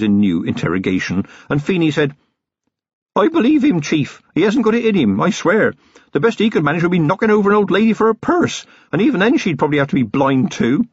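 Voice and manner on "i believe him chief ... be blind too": put-on high, keen young copper voice; eager young constable. A narrator's reading. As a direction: vouch for him with glee. Constable vouches for Flutter.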